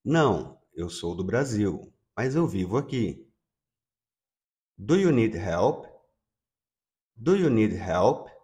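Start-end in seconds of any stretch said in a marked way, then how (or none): none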